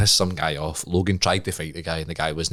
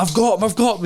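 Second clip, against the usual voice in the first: much higher, breathless glee; excited boast; 12 semitones higher, 3 dB louder than usual. Retold or imitated. Running into dad's house, much higher and gleeful. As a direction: burst in bragging.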